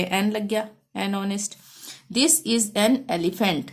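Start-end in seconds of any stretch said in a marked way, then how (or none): 2.2-3.6 very slow, each word spaced out; slow dictation. Shown as a demonstration. Teacher reading an example sentence to pupils, slow and clear. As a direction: read it out slowly for the class.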